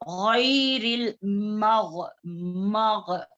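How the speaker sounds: slow गैरिल मग with a heavy ghain; slow teacher demo; recitation demo. Shown as a demonstration. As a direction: model गैरिल मग slowly for students. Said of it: "हम इसको कैसे पढ़ेंगे?".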